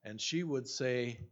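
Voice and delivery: usual speaking voice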